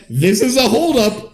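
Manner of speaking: high laughing holdup line; mock robber. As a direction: announce the robbery through a laugh. Mom robbing the bank.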